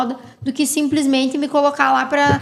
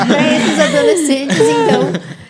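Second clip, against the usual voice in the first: talking through a laugh, higher and louder; 4 semitones higher, 7 dB louder, slower than usual. Real, not acted. Speaker joking with colleagues about being a teenager, laughing. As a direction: get the words out while cracking up.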